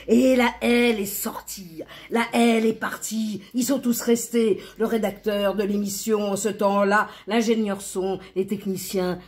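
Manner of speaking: heightened narration. A narrator's reading. Narrator telling who stayed behind in the studio, heightened. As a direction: push the list forward urgently.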